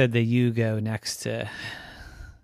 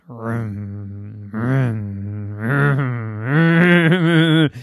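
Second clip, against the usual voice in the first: engine slowly revving; 8 dB louder, much slower than usual. A narrator's reading. Rocky revs his engine in the jar, building. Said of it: "slowly he revved his engines".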